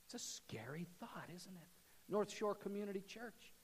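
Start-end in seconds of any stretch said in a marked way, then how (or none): 0.1-1.7 whispered, ominous question. Real, not acted. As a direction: lean in and whisper it. Hushed question to the congregation.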